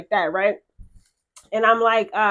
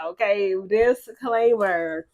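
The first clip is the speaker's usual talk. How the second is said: slow announcement; 2 semitones lower, much slower than usual. Shown as a demonstration. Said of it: "Okay, this is a disclaimer".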